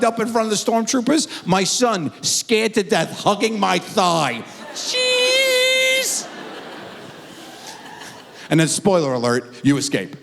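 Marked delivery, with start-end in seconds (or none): 4.7-6.2 very high drawn-out jeez; exasperated squeak. Retold or imitated. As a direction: squeak it like a cartoon. Scared son at photo.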